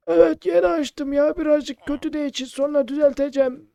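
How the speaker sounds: lightly voiced old shopkeeper explaining.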